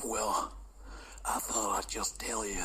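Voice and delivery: whispered caller. Acted.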